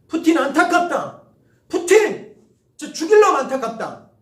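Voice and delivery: loud, high outburst at Putin; frustrated yelling at Putin; exasperated shout. Real, not acted. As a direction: vent fury while claiming pity.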